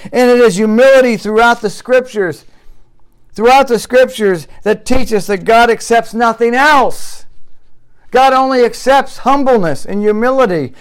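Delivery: loud, high, impassioned sermon voice; fiery preaching. Acted. Pastor preaching hard to the congregation, impassioned and loud. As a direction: preach it out with full force.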